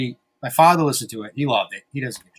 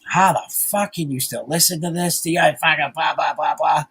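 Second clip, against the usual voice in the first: mock disgusted wife; 4 semitones higher than usual. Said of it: "she's like, disgusted".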